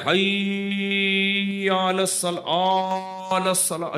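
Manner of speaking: very slow, warbling mock call to prayer; mocking odd azan. Preacher mocking a strange azan heard locally, slow warbling. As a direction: parody a strange muezzin's drawn-out call. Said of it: "عجیب عجیب اذانیں ہو رہی ہیں".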